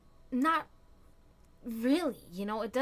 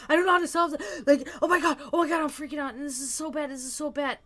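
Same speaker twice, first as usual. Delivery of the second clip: higher, louder panicky self-imitation; mock freaking out; 4 semitones higher, 4 dB louder, much faster than usual. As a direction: play your own panic, half in fun. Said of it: "I'm like".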